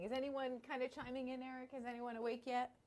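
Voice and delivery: normal talking voice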